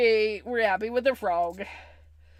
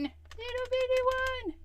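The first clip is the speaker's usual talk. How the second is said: cutesy high voice; 13 semitones higher than usual. Real, not acted. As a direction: coo it like to something tiny. Cutesy echo of the phrase.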